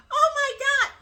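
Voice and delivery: high, loud thrilled gasp of a cry; first impression. Woman reacting on first seeing the ring, thrilled cry. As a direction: exclaim it in high-pitched delighted shock. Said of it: "my first impression".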